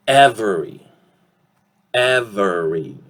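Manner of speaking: "Every" as EV-er-ee, middle vowel kept; full three-syllable form. Shown as a demonstration. Teacher introducing the next word, unreduced "Every" as EV-er-ee. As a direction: say "Every" carefully with the middle e sounded. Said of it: "we have that e vowel sound".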